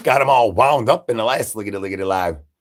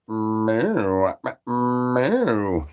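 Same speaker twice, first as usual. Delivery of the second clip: cat meow. Acted. Imitating a cat.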